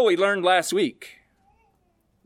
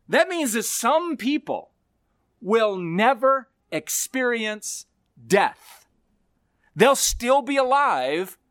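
ordinary talk

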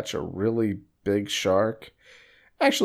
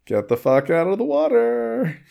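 mock yell; 10 semitones higher than usual. Movie quote yelled.

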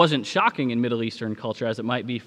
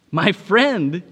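louder, higher startled protest; indignant exclamation; 5 semitones higher, 7 dB louder than usual. Acted. Pastor objecting to Washington calling Whitman his friend, exclaiming. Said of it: "he exclaimed".

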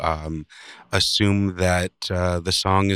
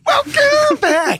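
very high, drawn-out rock screech; 5 dB louder, much slower than usual. Acted. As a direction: screech it slowly like a rock frontman. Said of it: "Is that like your Van Hagar voice?".

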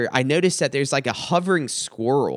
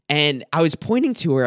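normal talking voice

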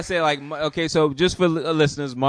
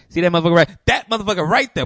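higher, louder call-out of that motherfucker; pointing him out loudly; 6 semitones higher, 8 dB louder, faster than usual. Retold or imitated. Pointing out the guy to Jenny, loud. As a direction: jab the words out loud and pointed.

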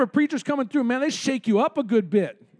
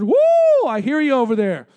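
shouted woo; 6 dB louder than usual. Retold or imitated. Pastor imitating a member shouting out in service, whooping. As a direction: call out like a fired-up church member. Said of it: "Brother Sean over there".

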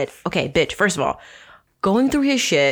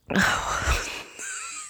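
long high exasperated sigh; 7 dB quieter than usual. Real, not acted. Dramatic sigh.

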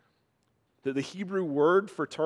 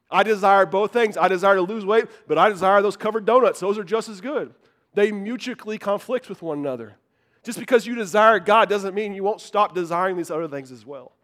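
louder, higher preaching drive; full-force preaching; 3 semitones higher, 6 dB louder than usual. Acted. Preacher confessing his donut cravings to the church, full-force preaching. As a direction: hammer the point home from the pulpit.

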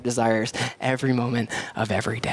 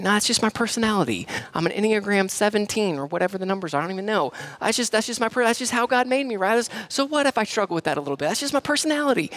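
mock excuse-making; 8 semitones higher, faster than usual. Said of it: "So what if I got a little angry".

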